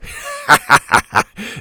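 loud hearty captain's laugh; pirate laugh. Acted. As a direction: laugh out big and gloating. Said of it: "The captain laughed".

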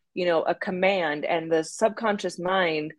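ordinary talk

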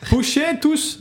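attempted Portuguese accent. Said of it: "si t'as peut t'aider pour l'accent".

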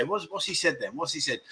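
mock whisper. A wife quietly asks her husband what was said, hushed. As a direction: lean over and ask under your breath. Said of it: "you'll say".

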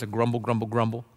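very low, soft mock mutter; grumbling murmur. Church member dismissing discipleship groups, in a low mock grumble. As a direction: mutter it monotonously, imitating a grumbler. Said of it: "this low-toned murmur".

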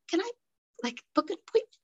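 barely daring to ask at the front desk; imitating her own tiny scared voice. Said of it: "my voice was so little".

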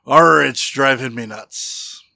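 slow, loud pirate growl. Retold or imitated. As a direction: Arr it out like a pirate. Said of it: "The pirate says".

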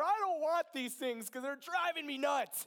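retold outburst, high and loud; exasperated prayer. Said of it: "hashing it out with God".

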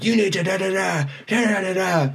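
high-pitched mock rant trailing into nonsense syllables; mock yelling. Burly guy yelling in her face, retold as mock yelling. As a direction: imitate a man yelling, pitch pushed up. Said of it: "starts yelling at me".

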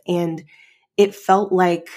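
ordinary talk

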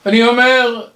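ordinary delivery

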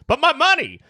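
high, squeaky cry from the back; crowd heckler. Retold or imitated. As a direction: whine it like a greedy townsman. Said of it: "somebody being like".

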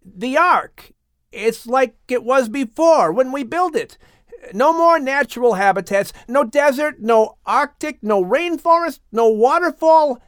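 high, loud, astonished report; excited. Acted. Ham tells father Noah the ark is bare, high and excited. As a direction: rattle off the list in disbelief.

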